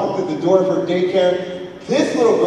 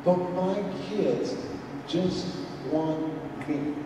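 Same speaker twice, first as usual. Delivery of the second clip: sincere, soft; 2 semitones lower, 11 dB quieter than usual. Real, not acted. Sincere closing message.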